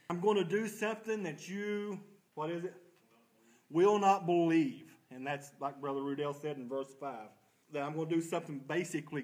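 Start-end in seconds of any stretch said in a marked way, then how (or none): 0.1-2.0 lightly voiced God, pitched higher; God's declaration. Teacher quotes God speaking to the prophet, raised declaration. 3.7-4.9 loud, slow quoted declaration; emphatic proclamation. Retold or imitated. Teacher completes God's words to the prophet, loud and emphatic.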